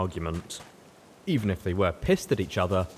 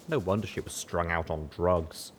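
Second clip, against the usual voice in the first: ordinary delivery, nothing marked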